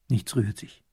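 usual speaking voice

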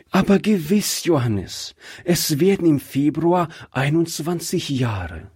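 the wife, mildly reproachful; wife's voice. Acted. Wife chides husband about the widow, lightly voiced.

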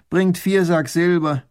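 loud, slow command; giving an order. Acted. Baron orders the soldiers to bring the silver. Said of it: "befiehlt Raffigny den Soldaten".

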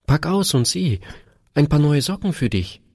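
put-on high-pitched little girl's voice; little daughter. A narrator's reading. Daughter telling Papa she missed him, high little-girl voice. Child gives father her present.

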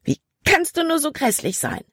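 high-pitched indignant outburst; exploding in anger. A narrator's reading. Libby rounds on Caleb for the snow, exploding. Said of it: "explodierte dann".

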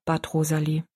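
ordinary delivery

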